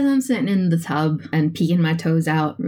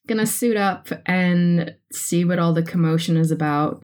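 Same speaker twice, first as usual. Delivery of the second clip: ordinary talk, nothing marked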